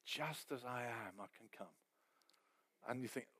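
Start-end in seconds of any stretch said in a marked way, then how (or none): 0.0-1.8 soft hushed hymn line. Retold or imitated. Quoting the hymn softly.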